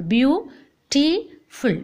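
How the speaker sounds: very slow "Beautiful" in three separate beats; long gaps between the syllables of "Beautiful"; split into syllables. Shown as a demonstration. Teacher showing three syllables to class, very slow syllable by syllable. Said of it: "Three syllable".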